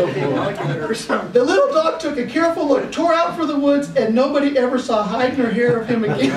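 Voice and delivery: can barely get the joke out; laughing through punchline. Real, not acted. Host finishing the hunting dog joke, laughing.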